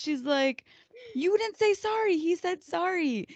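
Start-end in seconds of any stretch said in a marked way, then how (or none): 1.1-3.3 high, loud mock telling-off; high, drawn-out reproach; scolding mom. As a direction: imitate a mother correcting her teen. Voices mom scolding older child.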